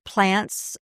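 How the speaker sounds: ordinary talk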